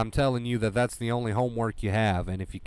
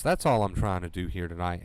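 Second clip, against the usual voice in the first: ordinary talk, nothing marked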